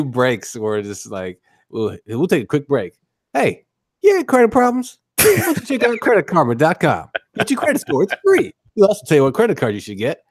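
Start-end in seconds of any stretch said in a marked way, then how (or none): 3.3-8.5 high, bright ad announcer pitch; hammed-up commercial read; mock commercial. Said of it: "What's this, a commercial?". 8.8-10.2 fast disclaimer. Pretend.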